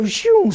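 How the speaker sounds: high swoosh for the flying axe head; whoosh sound. Retold or imitated. (sonido de silbido agudo)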